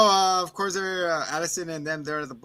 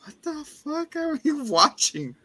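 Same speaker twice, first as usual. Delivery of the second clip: higher, slow disbelief with a laugh; incredulous; 11 semitones higher, slower than usual. Retold or imitated. Viewer's disbelief.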